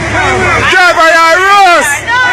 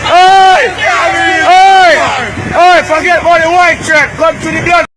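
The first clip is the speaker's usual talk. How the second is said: shouting. Real, not acted. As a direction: bellow to be heard over the flood. Shouting to people in flood.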